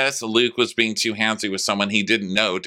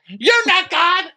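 very high, loud mock shriek; high screamed retort; screaming argument; 5 dB louder, slower than usual. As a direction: shriek the denial, imitating a furious fight. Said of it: "they're screaming at each other".

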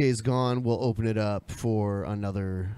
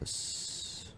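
whispered yes; 17 dB quieter than usual. Pretend. Whispered reply.

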